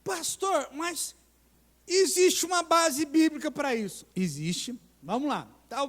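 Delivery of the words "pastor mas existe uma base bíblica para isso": fiel perguntando. Retold or imitated.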